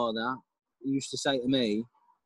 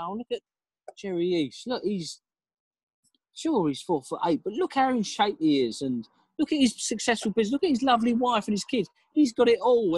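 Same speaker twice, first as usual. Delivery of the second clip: lightly voiced admiring onlooker, higher pitched and quickening; mock envious gushing; 6 semitones higher than usual. Speaker imitating people admiring someone else's life, lightly mocking envious gush. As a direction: gush over him like a jealous neighbour, lightly.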